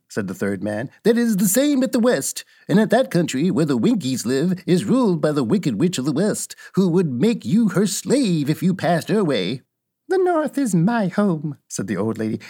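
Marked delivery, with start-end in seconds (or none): 1.0-9.6 lightly voiced storybook townsman, raised pitch; a touch of Munchkin warning; warning Munchkin man. Third Munchkin warning Dorothy about the West, lightly voiced character. 10.1-11.6 put-on high, slow old lady voice; old witch. Old witch telling of her home, high old lady voice.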